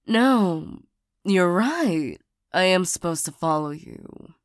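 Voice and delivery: ordinary delivery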